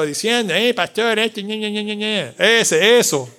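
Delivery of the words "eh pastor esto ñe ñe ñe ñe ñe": a little whiny ñe ñe, trailing softer; mock whining. Pastor mimics church members whining at him, mild mock whine. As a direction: imitate a complainer nagging the pastor. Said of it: "que siempre están llorando".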